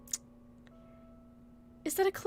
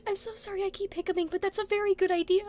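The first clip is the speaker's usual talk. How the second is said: ordinary talk, nothing marked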